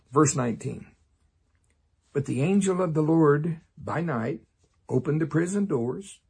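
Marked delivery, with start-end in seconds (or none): none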